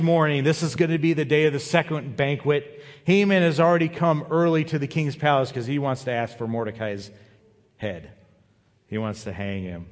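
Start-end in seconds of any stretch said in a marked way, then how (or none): none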